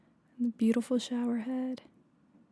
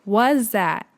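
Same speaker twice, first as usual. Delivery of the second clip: retold startled yell; 11 dB louder, much slower than usual. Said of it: "I go screaming and I'm like".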